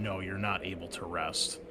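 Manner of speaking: ordinary delivery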